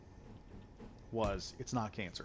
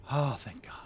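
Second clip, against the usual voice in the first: whispered relief; 2 semitones higher than usual. Retold or imitated. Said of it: "we're like".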